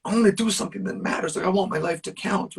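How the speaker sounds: high-pitched, urgent plea without much volume; retold scream. Man retelling his plea to the sky for purpose, mild high-pitched scream. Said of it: "I screamed at the sky".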